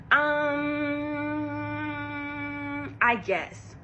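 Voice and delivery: drawn-out hesitant reply; grudging shrug of a reply; reluctant um I guess. Retold or imitated. Bria answering Janelle, grudging and hesitant. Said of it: "like that".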